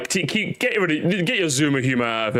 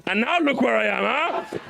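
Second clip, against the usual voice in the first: mock grandiose boast; 7 semitones higher than usual. Streamer mock-boasting to his friend about internet fame, hammed-up. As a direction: ham up being a big internet star.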